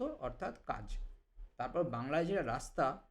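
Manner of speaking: usual speaking voice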